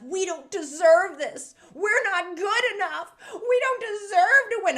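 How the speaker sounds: high, loud wail of mock despair; imitating the tearful cellist. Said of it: "She was like".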